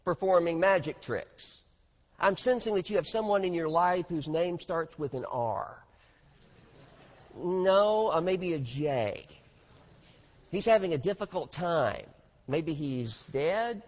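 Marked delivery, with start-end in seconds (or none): 2.2-13.8 mock TV psychic. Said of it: "a modern television psychic".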